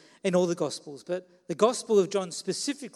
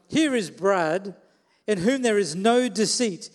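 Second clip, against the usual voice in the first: louder, slow, grand announcing voice; mock proclamation; 2 semitones higher, 7 dB louder, slower than usual. Pastor pretends Jesus praises him, in a mock grand proclamation. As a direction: proclaim it like a herald, tongue in cheek. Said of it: "I'm like".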